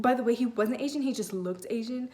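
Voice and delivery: ordinary talk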